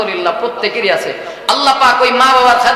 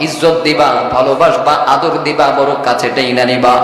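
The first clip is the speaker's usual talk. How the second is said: tender sermon tone; 11 semitones lower than usual. Acted. Preaching about speaking softly to parents.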